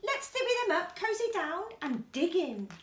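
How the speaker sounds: high, cheerful badger voice; grinning badger. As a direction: offer the treat with a grin. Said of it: "badger says with a grin".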